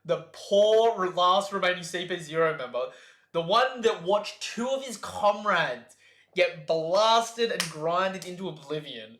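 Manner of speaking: ordinary talk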